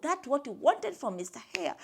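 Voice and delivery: ordinary delivery, nothing marked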